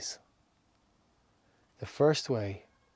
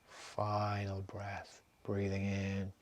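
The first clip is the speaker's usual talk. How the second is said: guided meditation; slower than usual. Acted. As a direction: lead them calmly from breath to breath. Leads a breathing meditation.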